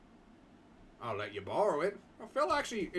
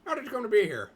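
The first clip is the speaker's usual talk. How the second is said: higher and louder, a bold take on the line; trial voice; 7 semitones higher, 8 dB louder, faster than usual. Shown as a demonstration. Testing a character voice on the question, higher and louder. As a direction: try the question high and loud. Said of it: "I'll do my best to do an accent".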